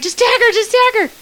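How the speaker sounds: high-pitched, urgent repeated plea; retold yelling. Player re-enacting her plea to tag the runner, yelling. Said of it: "I'm yelling at the top of my lungs".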